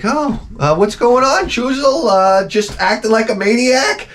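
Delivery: high-pitched, uneasy chatter; nervous questioning. Acted. Spooked owner questioning his dog, hammed-up nervousness.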